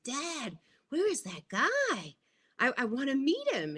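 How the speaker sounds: put-on high, soft little-kid voice; small high voice, imitating a child; imitated child. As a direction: play a curious kid asking dad. Child self asking her dad.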